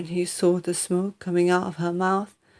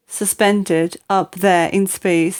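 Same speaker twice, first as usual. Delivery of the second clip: ordinary delivery, nothing marked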